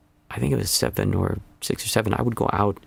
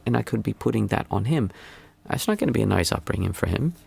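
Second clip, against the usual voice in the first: ordinary delivery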